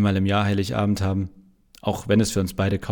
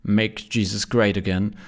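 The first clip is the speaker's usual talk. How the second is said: put-on slow, grandiose American accent; mock-solemn American rally slogan; slower than usual. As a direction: proclaim it like a pompous American politician. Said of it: "pathetisch und mit amerikanischem Akzent".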